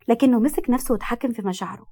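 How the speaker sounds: usual speaking voice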